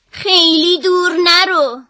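a mother calling after her son; mother's caution. A narrator's reading. Mother warning her son not to go far, lightly caring.

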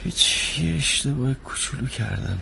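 low, subdued, half-voiced confession; sheepish, dropped voice; sheepish admission. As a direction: admit it reluctantly, low and soft.

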